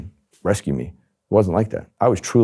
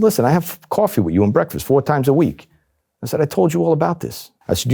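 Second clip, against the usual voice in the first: exasperated; 7 semitones higher than usual. Retold or imitated. Spinning an exasperated cover story.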